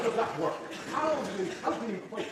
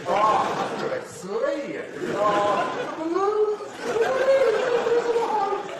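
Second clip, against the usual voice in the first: much higher, louder, slow overwrought cries; mock wailing; 12 dB louder, much slower than usual. A parent hamming up sobbing over the film Up. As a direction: wail like the film broke your heart.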